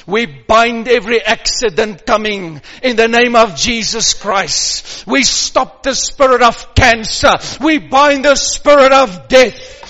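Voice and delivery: loud, forceful binding of evil; fierce commanding prayer. Acted. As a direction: command the spirits away with full force. Binding curses in Jesus' name.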